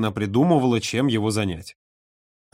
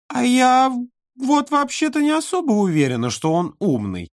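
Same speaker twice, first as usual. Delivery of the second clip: put-on high, musing child voice; thoughtful little girl; 12 semitones higher than usual. A narrator's reading. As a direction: ponder it like a cheeky child. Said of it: "задумчиво сказала".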